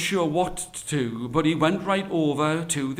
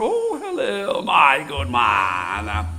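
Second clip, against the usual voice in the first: coaxing tiger; 2 semitones lower, 3 dB louder, slower than usual. Acted. Tiger asking the good man for freedom, coaxing. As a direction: wheedle slowly as a caged tiger.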